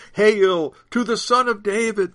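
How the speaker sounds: high, loud acclamation; shouted hail. A narrator's reading. Crowd hailing the Son of David, shouting. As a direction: hail him loudly. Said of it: "they shouted".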